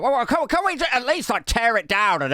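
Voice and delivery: high, stammering mock plea; pleading. Keir pleads to demolish the house.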